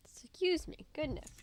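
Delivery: strained voice after a coughing fit; recovering from a cough, throat catching. Real, not acted. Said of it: "Sorry, I'm coughing".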